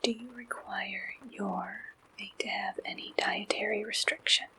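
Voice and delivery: ordinary delivery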